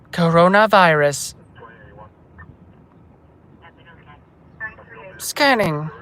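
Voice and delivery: slow machine announcement; filtered scanner voice. Pretend. Scanner checking for coronavirus, slow filtered voice. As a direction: announce each step slowly, like a scanner.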